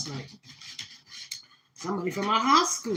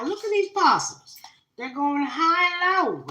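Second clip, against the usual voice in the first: excited; 8 semitones higher, 6 dB louder than usual. Real, not acted. Calling out the spirit cards.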